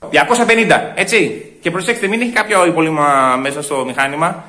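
ordinary talk

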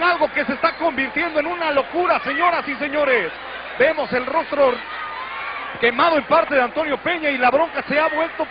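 high, fast alarmed live report; excited reporting. Real, not acted. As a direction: report the chaos urgently. Reporter alarmed by backstage brawl.

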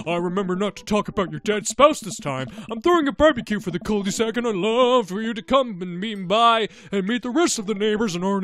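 high, loud put-on neighbor greeting; cheery neighbor character. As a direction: pitch the barbecue with hammed-up friendliness. Voicing the neighbor with huge smile.

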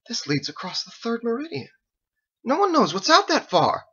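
put-on high anxious young officer; alarmed corporal.

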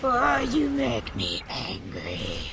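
low, slow monster growl; angry voice. Pretend. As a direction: put on a slow, deep angry voice. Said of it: "That's my angry voice".

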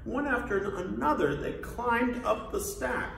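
plain reading voice

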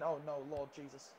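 ordinary talk

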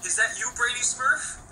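high, alarmed character voice; mock shocked voice. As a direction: gasp at the Smurf on steroids. Mock shocked question to Smurf.

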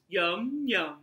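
ordinary delivery

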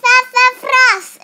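high, loud cheer of sassafras; yelled. Acted. Boys yelling sassafras for the photo. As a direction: shout it at the camera. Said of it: "Max and David yelled".

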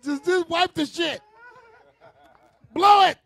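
high, loud exasperated outburst; exasperated yell. Acted. Husband to crying woman about her runny nose, exasperated. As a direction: yell it out, fed up with the mess.

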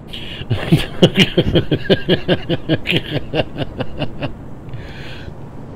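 breathless silent laugh; wheezy laugh. Real, not acted. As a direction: laugh almost without voice. Laughing at own joke.

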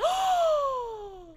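a loud, high gasp of shock; startled gasp; sharp gasp. Real, not acted. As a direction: gasp as if scandalised. Dismay at employee drinking customer's drink.

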